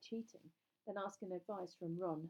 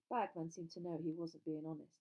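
usual speaking voice

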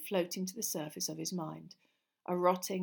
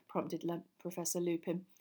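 plain reading voice

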